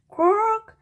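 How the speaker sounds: high, loud bird croak; raven croak. A narrator's reading. Raven croaking as it flaps its wings. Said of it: "he croaked".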